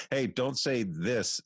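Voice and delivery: casting director. Retold or imitated. Casting director stopping the talent at the mic, lightly acted correction. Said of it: "the casting director will say".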